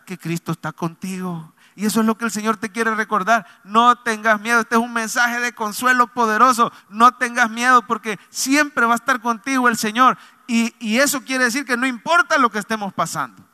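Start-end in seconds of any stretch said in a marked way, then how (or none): 1.8-12.4 rising, louder preaching voice; fervent sermon delivery. Acted. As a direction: preach it with conviction to the congregation. Preacher urging not to fear.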